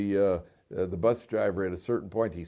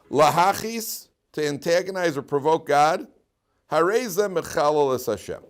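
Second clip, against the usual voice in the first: slow, higher, louder recitation; preaching; 6 semitones higher, 6 dB louder than usual. Acted. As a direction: intone the text like a sermon. Preaching Rambam.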